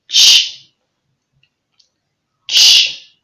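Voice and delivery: "ch" hissed, voiceless; ch sound. Shown as a demonstration. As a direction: model "Ch" twice, sharp and unvoiced.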